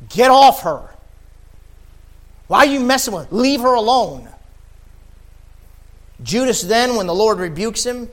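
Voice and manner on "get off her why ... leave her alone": loud, high stern rebuke of a grumbler; loud high rebuke, full force. Acted. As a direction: scold him sharply, like Jesus protecting the woman. Jesus rebuking Judas, acted.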